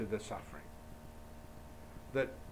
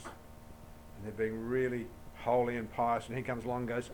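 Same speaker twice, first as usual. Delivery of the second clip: ordinary delivery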